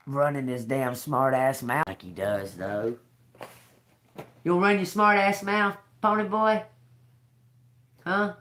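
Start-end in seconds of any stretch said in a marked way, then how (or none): 4.4-8.3 high teasing voice to the bird. Real, not acted. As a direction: needle the bird playfully, pitched up.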